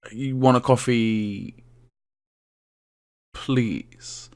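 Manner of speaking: low, soft, slow, long pause before "please"; dramatic pause. Retold or imitated. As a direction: hold back "please" after a long, pointed gap. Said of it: "did you hear that dramatic pause".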